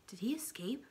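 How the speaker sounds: hushed, breathless question; whisper. Acted. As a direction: ask it under your breath. Boy asks secretly at night.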